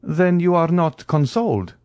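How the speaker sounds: high-pitched questioning surprise; surprised. A narrator's reading. Count questions Morrel about his grief, surprised. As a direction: ask it high, taken aback.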